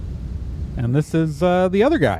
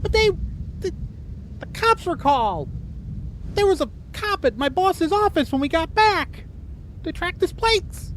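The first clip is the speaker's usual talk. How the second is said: eager employee; 4 dB quieter than usual. Acted. GM voices the employee.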